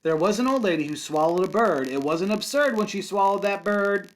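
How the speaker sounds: lively singsong read-aloud for kindergarten; picture book storytime voice; reading to children. Acted. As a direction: read it with a bouncy rhythm for little kids. Teacher reads picture book aloud.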